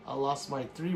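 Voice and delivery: ordinary talk, nothing marked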